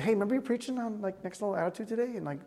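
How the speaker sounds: soft, quick inner nudge; quietly prod, as a voice of conscience; hushed retold reproof; gentle conviction. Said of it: "the Lord convicted me".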